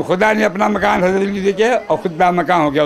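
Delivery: usual speaking voice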